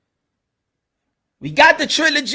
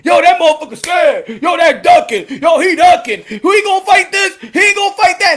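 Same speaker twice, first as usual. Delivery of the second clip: loud, higher mocking shouts; mock angry yell; 5 semitones higher, 6 dB louder than usual. Fans shouting that Ryan is ducking, mock yell. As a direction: jeer like a furious fan.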